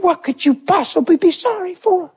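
high, emotional, incredulous plea; high-pitched, overwhelmed questioning; retold tearful question. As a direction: imitate asking through tears. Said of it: "And I was crying".

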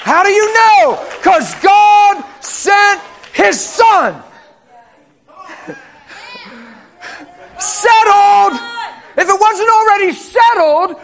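loud, high preaching climax; full-force preaching. Real, not acted. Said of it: "Man, I'm preaching".

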